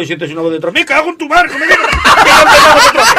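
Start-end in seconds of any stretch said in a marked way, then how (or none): 0.7-1.6 much higher, louder curse through laughter; shouted curse. Acted. Priest hearing the boy in the confessional, shouted curse. 1.6-3.2 very high, very loud and slow, dissolving into laughter; drawn-out shrieking curse. Acted. Priest repeating his curse at the boy, drawn-out shriek.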